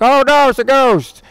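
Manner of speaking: high, hammed-up fake scare; sarcastically playing spooked, pitched well up; sarcastic mock fright. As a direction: tease them with pretend alarm. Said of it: "been a little more sarcastic".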